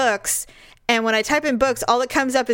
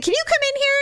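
high, light voice calling someone over; sweetly calling over; much faster than usual. Retold or imitated. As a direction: re-enact a polite request, pitched way up. Calling husband in from game.

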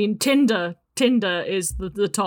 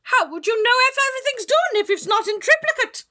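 be outraged as a witch; witchy voice; 4 dB louder than usual. Acted.

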